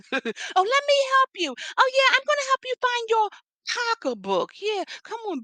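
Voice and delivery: high, loud fake-sweet mock helper voice; mocking sweet lady. Mocking the leasing lady's fake-sweet offer to help. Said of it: "laughs; mocking the leasing lady".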